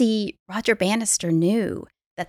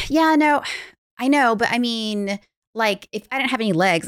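higher, exasperated pushback; mock annoyed client; 4 semitones higher, 4 dB louder than usual. Client objecting to her coach in a session, mildly annoyed. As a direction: imitate an exasperated client, starting high and loud, then easing. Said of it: "she was just annoyed with me".

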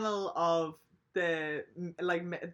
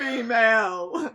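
slow, loud and higher, laughing through it; smug old man tacking on the word; pompous old bastard; 5 semitones higher, 11 dB louder, much slower than usual. Retold or imitated. Old panelist finishing his answer, smug mocking laugh. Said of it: "this old bastard".

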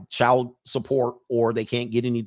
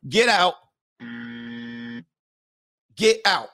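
mock get out; 3 semitones lower than usual. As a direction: order her out in mock outrage. Throwing her out.

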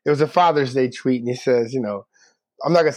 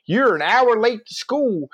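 higher-pitched mock scolding counselor; guidance counselor imitation; 8 semitones higher than usual. Guidance counselor confronts a late new student, mock scolding. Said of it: "she's the guidance counselor down there".

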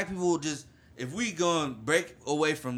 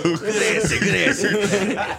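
amused; 3 semitones higher, 7 dB louder, slower than usual. Real, not acted. Amused approval after laughter.

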